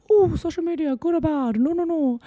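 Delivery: high, mock preachy lecturer; preachy speech. Vlogger mocking a preachy social media speech, high and hammed-up. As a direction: parody a smug know-it-all lecturing from a high horse. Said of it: "giving out this big speech about".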